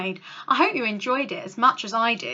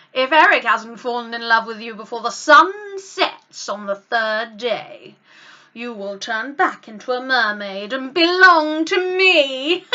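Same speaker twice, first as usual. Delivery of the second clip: louder, gloating witch bargain; wicked sea witch; 2 semitones lower, 6 dB louder, slower than usual. Acted. Ursula offers Ariel legs, gloating witch voice with a laugh. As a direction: lay out the deal with relish. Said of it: "cackled Ursula".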